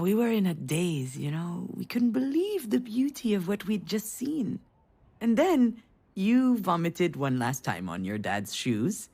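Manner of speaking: usual speaking voice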